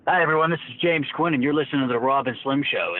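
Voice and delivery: radio intro. Pretend. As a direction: hype up the show intro. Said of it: "So can you say for me right now".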